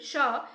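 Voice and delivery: "sha" as a lone sh with a short uh; SH sound. Shown as a demonstration. Teacher showing students how the word begins, SH sound. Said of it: "here we pronounce as should".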